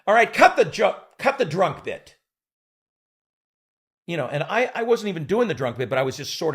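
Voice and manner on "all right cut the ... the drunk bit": loud, higher command; curt director order. Retold or imitated. Director ordering the actor to cut the drunk bit, loud. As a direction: snap the note out. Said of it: "Hal says".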